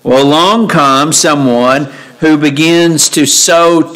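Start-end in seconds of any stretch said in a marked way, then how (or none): none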